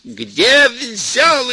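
furious mother-in-law yelling at full force; yelling with all her might. Acted. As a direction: scream the question at full strength. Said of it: "изо всех сил кричала".

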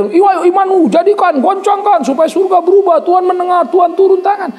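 high, loud mocking hype; mock a pushy faith-shouter; hammed-up excited faith cheer; mock hype preacher.

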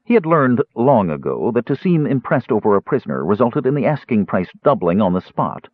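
ordinary delivery

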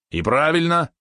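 high, pleased approval; delighted. A narrator's reading. Valeron welcomes building a second spider, high and delighted. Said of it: "обрадовался Валерон".

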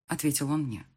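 usual speaking voice

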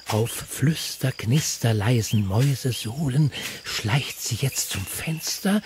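usual speaking voice

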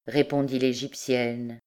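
plain reading voice

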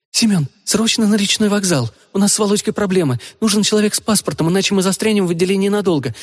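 frantic call for help, rushing; shaken tearful witness. Acted. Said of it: "Она сбивчиво рассказала".

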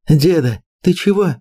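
high, alarmed call; frightened. Acted. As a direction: cry out in fright. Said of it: "испугалась Лера".

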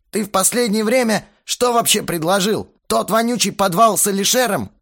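high, loud indignant challenge; heated accusation. A narrator's reading. Quarrel, jumping up to accuse. (с наездом, на повышенных тонах)

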